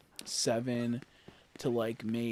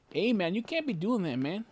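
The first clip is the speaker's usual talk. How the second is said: ordinary talk, nothing marked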